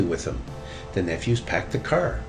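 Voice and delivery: usual speaking voice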